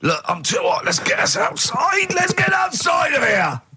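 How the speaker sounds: high, slow mock shout building up; hammed-up cartoon yell, slowing down; testicles shouting. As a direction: shout it out as if the balls want to escape. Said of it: "The balls didn't say".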